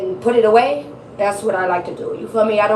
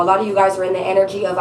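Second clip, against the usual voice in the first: usual speaking voice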